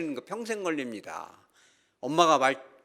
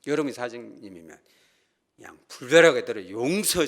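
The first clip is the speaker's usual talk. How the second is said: usual speaking voice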